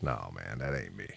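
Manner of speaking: very low, soft retold protest; quiet refusal. Host retells turning down the country costume, low and hushed. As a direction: drop right down in pitch and volume.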